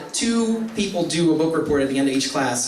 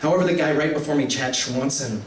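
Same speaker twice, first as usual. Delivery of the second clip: ordinary talk, nothing marked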